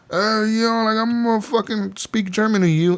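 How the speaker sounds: high, mock-foreign German man's voice; mock German guy. Mock German debtor answering Arthur, high and hammy. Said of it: "he's like".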